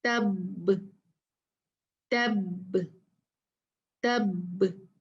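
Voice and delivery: तब with the ब stopped and bounced; stop with echo. Shown as a demonstration. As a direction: clip तब short and let the ब echo. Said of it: "तो कैसे पढ़ेंगे?".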